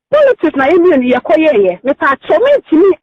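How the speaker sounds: ordinary talk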